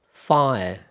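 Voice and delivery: British "Fire" with no R at the end. Shown as a demonstration. Teacher labelling the British "Fire" without R.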